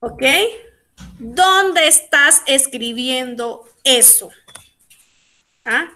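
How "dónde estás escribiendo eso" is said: slow, spaced-out question; slow dictation. Shown as a demonstration. Teacher gives class a sentence to translate, slowly and deliberately. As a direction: give each word room for learners.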